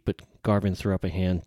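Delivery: plain reading voice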